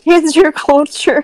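loud, slow mocking repeat; teasing echo. Friend mocks the "cancer culture" slip, loud teasing echo.

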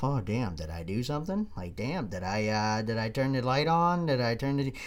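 low, soft worried self-talk; thinking to yourself. Retold or imitated. Husband going back over the morning, thinking to himself. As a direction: murmur it as a private thought. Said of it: "start thinking to yourself".

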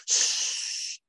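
sharp unvoiced shush. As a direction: hiss a shush at them. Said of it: "aggressively shushing us".

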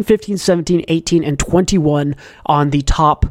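ordinary talk

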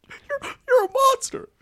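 drawn-out tiny bug squeak; high cricket voice. Retold or imitated. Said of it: "That's good".

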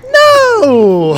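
high, loud, outraged "No!"; indignant shout. Acted. Mock-outraged reply to being asked to describe Jim, shouted. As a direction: snap back as if insulted. Acted outburst in imagined scenario.

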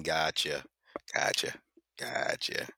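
lightly put-on office voice, soft and low.

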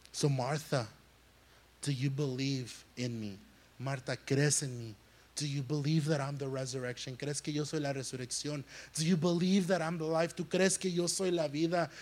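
gentle question. Acted. Preacher voicing Jesus gently asking.

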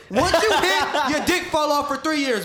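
high, loud laughing burst; laughing while he explains. Real, not acted.

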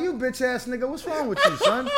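high, loud and laughing throughout; laughing mock yell. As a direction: cuss him out while cracking up. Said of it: "you'll be like".

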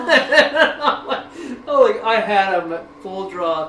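laughing, high and loud, slowed down; real laughter at your own bad luck. Real, not acted. Laughing at the failed hunt.

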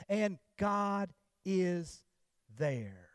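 drawn-out and God is, pause, then a dropped there; dramatic pause. Acted. Pastor reveals God at the bottom of the ocean, slow dramatic pause.

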